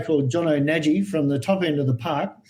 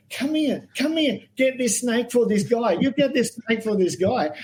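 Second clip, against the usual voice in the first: mild mock yell, higher pitch; imitating excited ladies calling out, pitched up; retold screaming; 6 semitones higher than usual. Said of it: "they're screaming at Gordo".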